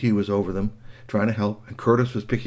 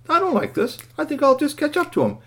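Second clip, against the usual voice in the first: very high little character voice; little robot; 3 dB louder than usual. Acted. Toy robot left behind by the boys, put out.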